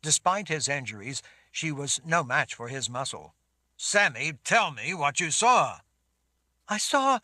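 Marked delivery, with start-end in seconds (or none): 3.8-5.8 loud, pressing command; urgent demand. A narrator's reading. Urgent demand to sobbing girl. 6.7-7.2 high, choked sob; sobbing. A narrator's reading. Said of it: "between sobs".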